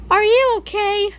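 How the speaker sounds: usual speaking voice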